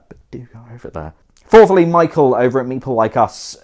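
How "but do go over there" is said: much quieter, quick undertone; hushed, hurried contradiction; sly aside. Real, not acted. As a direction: slip it in quickly under your breath. Sly aside reversing the instruction.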